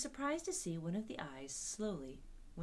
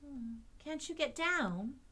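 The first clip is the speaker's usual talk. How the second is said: put-on little girl voice; 8 semitones higher, slower than usual. A narrator's reading. Dorothy voiced in story.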